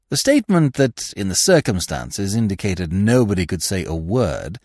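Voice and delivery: ordinary delivery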